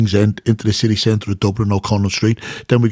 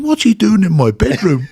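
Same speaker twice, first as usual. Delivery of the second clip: laughing; 9 semitones higher than usual. Retold or imitated. Retold confused thought, laughing.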